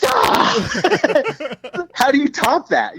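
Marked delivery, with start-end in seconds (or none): 0.0-1.9 high, short astonished yelp; amazed shout. Retold or imitated. [name] reacting to the [name] story with a high yelp. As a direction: yelp in disbelief.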